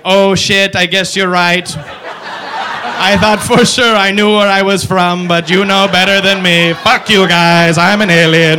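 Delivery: concede, then snap back loud; alien giving in. Retold or imitated. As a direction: alien accepting that it comes from Dave. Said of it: "the alien's like".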